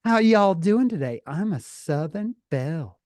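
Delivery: playful mock Southern accent; Southern belle. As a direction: imitate a girl as a Southern belle. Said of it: "she'd be like".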